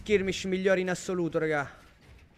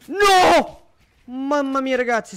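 alarmed shout; 4 semitones higher, 6 dB louder, slower than usual. Real, not acted. Caster sees near fall.

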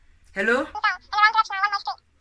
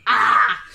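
loud anguished cries; shocked wailing; 6 dB louder than usual. Acted. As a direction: wail as if heartbroken.

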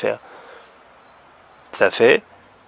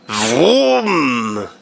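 playful engine "vroom" with a buzzing V; car vroom. Shown as a demonstration. Teacher imitating a car to show the V sound. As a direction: rev like a toy car. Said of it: "Ou alors".

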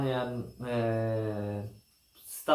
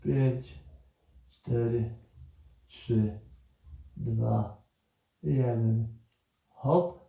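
counting down to go; 3 semitones lower, slower than usual. Acted. Coach counts down a timed hold.

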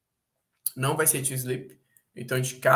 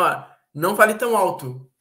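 ordinary talk, nothing marked

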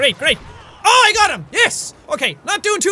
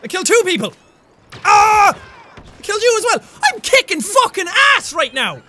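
ordinary talk, nothing marked

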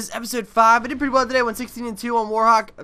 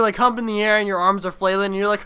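normal talking voice

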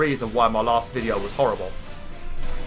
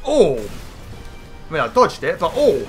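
surprised; 6 semitones higher, 5 dB louder than usual. Real, not acted. Boss attack hits in game.

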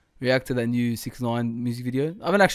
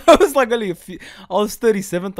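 excited; 7 semitones higher, 11 dB louder than usual. Real, not acted. Near miss in game.

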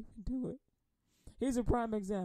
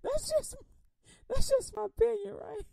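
laughing aside; 13 semitones higher, 5 dB louder than usual. Real, not acted.

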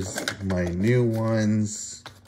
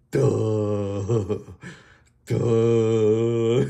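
dopey toy voice. Pretend. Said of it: "he's like".

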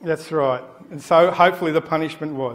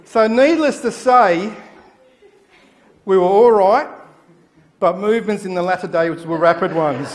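laughing; 3 semitones higher, 8 dB louder than usual. Real, not acted. Laughing at punchline.

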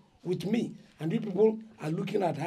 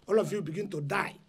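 ordinary talk, nothing marked

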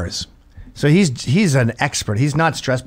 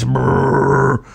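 motorcycle rumble. Retold or imitated. Harley noise.

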